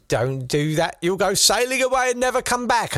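mock motherly warning rising high; worried mum. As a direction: climb into a high, hammed-up mum's warning. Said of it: "his mum was like".

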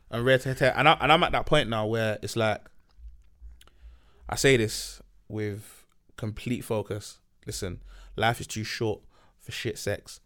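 ordinary delivery

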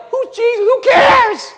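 very high, loud mock jeer; scornful culture. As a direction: cry it out as a dismissive scoffer. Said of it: "it looks like the culture".

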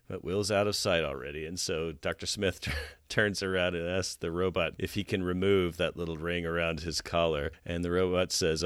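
usual speaking voice

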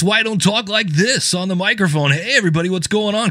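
high, put-on announcer hello; fake podcast voice. Shown as a demonstration. Mocking a fake podcast voice for listeners. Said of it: "I don't talk like that in real life".

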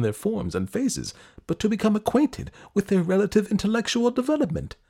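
high, anxious prince voice; puzzled and uneasy, asking questions; perplexed prince. Acted.

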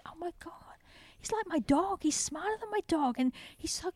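hushed, breath-only amazement; awed whisper. Retold or imitated. Passer-by realising pig is like dog, awed whisper. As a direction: whisper it like a wonderstruck onlooker. Voices people moved by the pig.

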